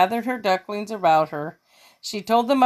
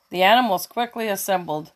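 ordinary delivery, nothing marked

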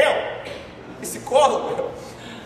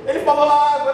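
a mild proud parent, louder; 4 dB louder than usual. Retold or imitated. (pai orgulhoso, leve)

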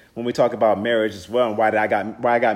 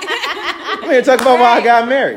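laughing, higher and louder; 11 semitones higher, 6 dB louder than usual. Real, not acted. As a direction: laugh while correcting yourself. Laughs at own slip.

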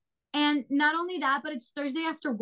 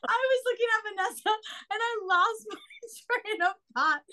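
laughing; 8 semitones higher than usual. Real, not acted. Laughing fit, lost thought.

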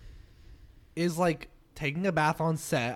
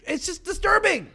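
high-pitched, exasperated protest; 13 semitones higher, 4 dB louder than usual. Real, not acted. Podcaster defending a verdict on Foul Phil, exasperated. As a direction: throw up the pitch as if fed up.

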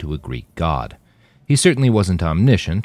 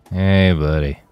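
a lightly voiced stoned drawl, a bit louder; lazy, hazy stoned greeting; super stoned; 2 semitones higher, 5 dB louder, slower than usual. A narrator's reading. Roland greeting Manny at the bar, mildly stoned. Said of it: "in a voice that was just super stoned".

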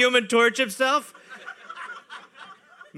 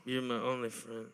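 quiet pleading; 9 semitones lower, 12 dB quieter than usual. Pretend. Playing drunk John, quiet.

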